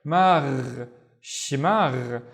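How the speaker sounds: "Magh, shimagh" with long gargled endings; slow throaty gargle closing each word. Shown as a demonstration. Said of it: "the one with the gargling".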